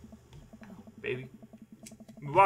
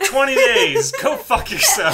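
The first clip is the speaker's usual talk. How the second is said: loud, high laughing outburst; laughing shout; 10 semitones higher, 10 dB louder, slower than usual. Real, not acted. Outraged laughing retort.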